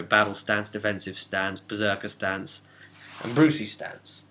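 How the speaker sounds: normal talking voice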